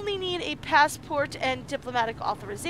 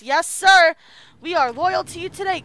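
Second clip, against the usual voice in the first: mock loyal soldier; 4 semitones higher, 10 dB louder than usual.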